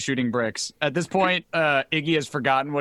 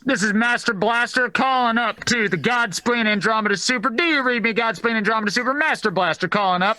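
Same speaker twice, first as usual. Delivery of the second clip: higher-pitched comms operator calling in; funny radio-protocol voice; 4 semitones higher than usual. Acted. Calling the Godspleen on the comm, funny protocol voice. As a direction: put on a silly military radio call. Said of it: "if I sound a bit funny".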